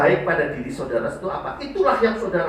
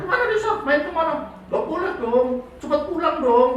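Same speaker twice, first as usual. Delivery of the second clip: higher, demanding questions; jealous boyfriend; 12 semitones higher, faster than usual. Pretend. Jealous partner interrogating Risa, high and demanding. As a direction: play the possessive partner, pushy and high. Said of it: "Risanya dicemburuin".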